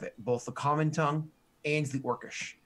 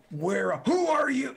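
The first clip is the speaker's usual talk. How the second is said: orc guard; 9 semitones higher, 4 dB louder, slower than usual. Acted. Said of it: "One of them starts to say".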